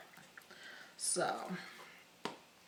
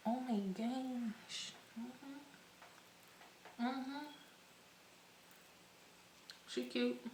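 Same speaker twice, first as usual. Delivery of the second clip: hushed admiration; 4 semitones higher, 16 dB quieter, much slower than usual. Real, not acted. Admiring the glow softly.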